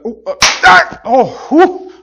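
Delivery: sudden high, loud yelp as the trap snaps; startled yelp. Pretend. Preacher nearly caught by his own rat trap, loud startled yelp. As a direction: jump back with a cry, hand nearly caught.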